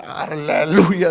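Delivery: drawn-out "Aleluya" in mild jubilation; retold hallelujah. Applicant praising God at his approval, slow drawn-out hallelujah. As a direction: stretch out a retold cry of praise.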